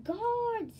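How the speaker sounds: loud, high commanding shout. Acted. Jack Frost calling his guards: loud shout. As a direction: bellow for the guards. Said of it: "he shouted".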